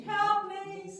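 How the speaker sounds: high, loud sung-out help me; mock cry for help. Shown as a demonstration. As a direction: wail it like a drama.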